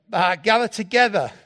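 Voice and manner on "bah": loud imitation sheep bleat; mock sheep call. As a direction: bleat loudly like one of the flock. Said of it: "that sheep do".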